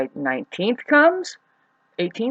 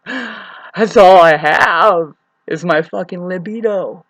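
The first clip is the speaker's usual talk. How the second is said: louder, slow, defiant declaration; defiant shout; 3 semitones higher, 14 dB louder, slower than usual. Real, not acted. Woman defending her sexual side, loud defiant declaration. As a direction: stand up for it loudly and slowly.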